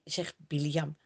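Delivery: plain reading voice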